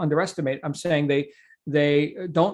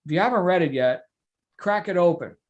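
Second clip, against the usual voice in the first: ordinary talk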